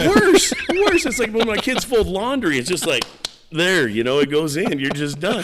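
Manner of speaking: high, loud laughing talk; amused, laughing rant. Real, not acted. Laughing at kids folding laundry.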